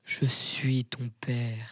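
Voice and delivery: very slow, drawn-out revelation; mock-solemn slow delivery; film villain parody. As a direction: stretch every word like a grand reveal.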